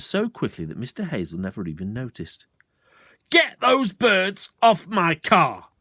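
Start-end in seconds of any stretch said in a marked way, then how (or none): 3.3-5.7 slow, louder furious yell; bellowed. Acted. Landowner bellowing slowly about his car. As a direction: drag each word out in rage. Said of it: "Mister Hazel bellowed".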